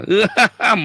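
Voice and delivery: loud, high mocking laugh; gloating laugh. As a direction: laugh at an enemy's downfall. Mocking an enemy's misfortune. (tawa mengejek yang keras)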